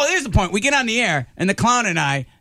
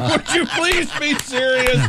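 mocking repeat; 8 semitones higher than usual. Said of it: "mocking Couric's request".

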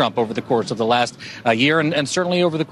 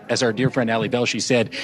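usual speaking voice

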